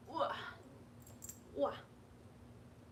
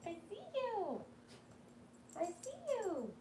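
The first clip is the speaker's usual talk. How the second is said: pet talk; 7 semitones higher, 7 dB quieter than usual. Real, not acted.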